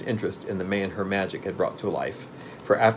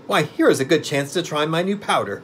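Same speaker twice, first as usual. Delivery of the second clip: put-on higher, eager old woman; 7 semitones higher, 4 dB louder than usual. A narrator's reading. Old witch eagerly planning to test her new powder, eager. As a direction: pitch it up with eagerness. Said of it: "said she eagerly".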